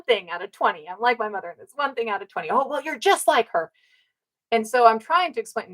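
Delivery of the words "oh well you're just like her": higher, mocking dismissive father voice. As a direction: sneer it back like the dismissive dad. Retold argument, voicing the father.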